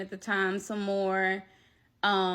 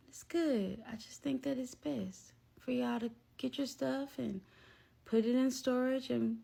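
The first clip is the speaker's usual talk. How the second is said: soft, slightly raised, emotionless voice; mellow imitation; 4 semitones higher, 7 dB quieter than usual. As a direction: imitate someone calmly brushing you off, very softly. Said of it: "and just like this".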